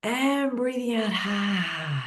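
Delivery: slow, drawn-out exhale cue; guided breath. Shown as a demonstration. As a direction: stretch it like a long out-breath. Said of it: "And now a big breath in".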